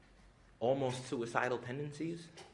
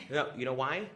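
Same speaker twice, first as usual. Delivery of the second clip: normal talking voice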